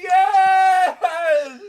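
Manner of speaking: high, laughing cheer; excited cheer. Real, not acted. Celebrating the touchdown.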